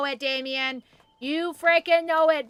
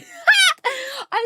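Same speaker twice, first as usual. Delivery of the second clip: high cackling burst of laughter; loud laugh; 8 dB louder than usual. Real, not acted.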